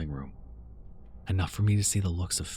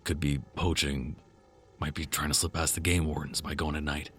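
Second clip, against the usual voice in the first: low, measured man's voice; level-headed guy reasoning; calm male voice; 4 semitones lower than usual. Acted. Andrew's voice.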